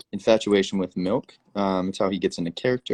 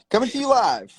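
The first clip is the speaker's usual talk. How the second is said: news anchor voice; 3 dB louder than usual. Pretend. Mock broadcaster intro.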